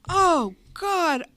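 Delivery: high, loud despairing wail; desperate loud "oh God"; anguished cry. Retold or imitated. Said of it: "throwing myself against the wall".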